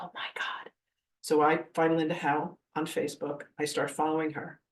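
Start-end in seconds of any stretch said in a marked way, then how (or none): 0.0-0.7 hushed, whispered amazement; soft, voiceless gasp of awe; awed whisper. Retold or imitated. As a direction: whisper it in retold disbelief. Retold awed reaction, whispered.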